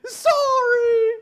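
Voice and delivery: high, loud wailing sorry; crying child. Retold or imitated. Father imitating daughter wailing sorry in tears. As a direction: wail like a sobbing toddler.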